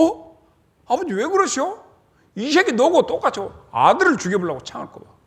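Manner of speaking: a son aghast at his raging father; high startled outcry; shocked protest. Acted.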